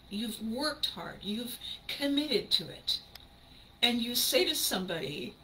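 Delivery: ordinary talk, nothing marked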